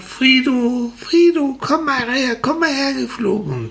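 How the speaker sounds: hoch und laut nach Frido rufen; lautes Rufen. Acted. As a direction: ruft laut den Spatz herbei, viel höher. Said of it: "ruft sie ganz laut". (Sonnenblume ruft laut nach dem Spatz Frido)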